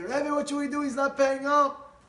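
high, complaining plea; exasperated call for help; worked-up appeal. Retold or imitated. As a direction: appeal urgently to the rabbi, stretching the end. Gabbai appeals to the Rebbe.

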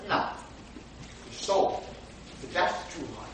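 ordinary talk

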